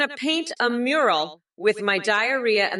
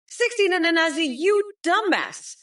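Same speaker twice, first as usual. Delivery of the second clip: mock yell; 9 semitones higher than usual. Outburst at chatter.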